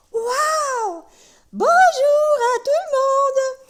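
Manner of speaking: very high, loud, slow puppet voice; a tiny teddy bear greeting the whole room. Acted. Puppet Tolo greets everyone, very high and loud. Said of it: "Ça, c'est Tolo".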